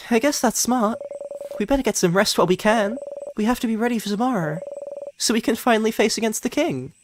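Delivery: put-on high, fast, light friendly girl voice; gentle teasing wake-up from a friend; cheerful friend. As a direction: tease a sleepy friend fondly. Voicing Mirabelle.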